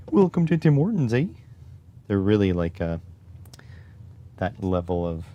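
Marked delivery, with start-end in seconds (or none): 0.1-1.3 high, loud rural Canadian twang. Shown as a demonstration. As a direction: lay on the Canadian accent thick, pitched way up. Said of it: "so the Canadian accent now".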